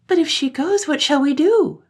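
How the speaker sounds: put-on high, loud child voice in despair; high panicked child voice; despairing children. A narrator's reading. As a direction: cry out in panic like little kids. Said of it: "they cried in despair".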